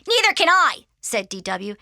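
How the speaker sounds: put-on high, loud kid's voice; bossy, piping demand; cheeky little sister. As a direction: pipe up to claim what is owed. Little sister demands her money.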